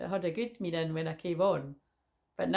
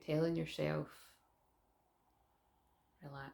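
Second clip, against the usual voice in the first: guided meditation; 6 dB quieter than usual. Acted. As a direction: guide them calmly into the breath. Leads a breathing meditation.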